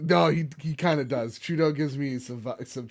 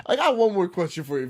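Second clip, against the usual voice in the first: usual speaking voice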